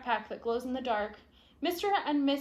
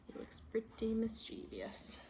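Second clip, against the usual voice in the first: soft whispered remark; 2 semitones lower, 11 dB quieter than usual. Real, not acted. Reader commenting on the picture, whispered.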